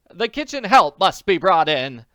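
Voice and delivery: loud, high insistence; loud insistent old woman; insisting. A narrator's reading. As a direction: demand it firmly, raising the voice. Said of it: "Missus Willoughby insisted".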